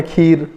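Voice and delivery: खीर with a lingering long vowel; long ee demo. Shown as a demonstration. Teacher demonstrating the pause on the vowel, खीर with ee drawn out. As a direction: hold the ee in खीर. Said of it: "यहाँ पे थोड़ा ठहराव आता है".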